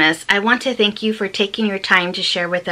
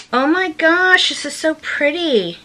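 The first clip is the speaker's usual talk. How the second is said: excited; 8 semitones higher than usual. Real, not acted. Opening a card from Sweden.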